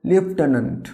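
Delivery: "লেফটেন্যান্ট" said lef-te-nant, with an f sound; correct pronunciation. Shown as a demonstration. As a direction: model "লেফটেন্যান্ট" with the f. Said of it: "তাহলে আমরা এর উচ্চারণ কী বলবো?". (সঠিক উচ্চারণ "লেফটেন্যান্ট")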